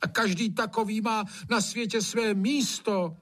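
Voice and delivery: put-on high-pitched pleading old gamekeeper; horrified servant. A narrator's reading. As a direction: plead earnestly for the poor creatures. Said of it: "zhrozil se Hajný".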